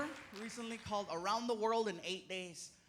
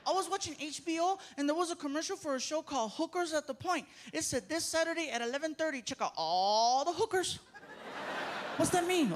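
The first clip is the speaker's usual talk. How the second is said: put-on high innocent child; kid voice; 7 semitones higher than usual. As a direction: ask it like a curious little boy. Son voice retelling TV ad.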